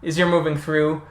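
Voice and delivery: ordinary talk, nothing marked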